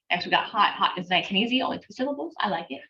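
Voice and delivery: normal talking voice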